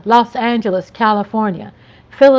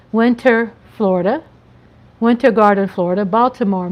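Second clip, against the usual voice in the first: usual speaking voice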